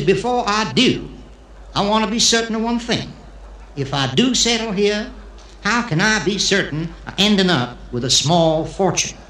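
hammed-up Texan visitor; Texan accent, good-old-boy style. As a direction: play a Texan with a Texas twang. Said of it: "the Texan who comes to Israel".

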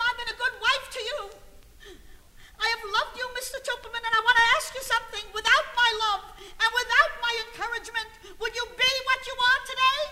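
high, loud, fast acted harangue; shouted tirade. Wife berating balloon-salesman husband in restaurant, shouted tirade. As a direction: build a breathless-paced marital rant at full volume.

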